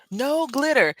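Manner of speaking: high mock refusal; cartoon teacher. Grown-up refusing the child glitter, high mock-strict voice. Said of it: "And she was like".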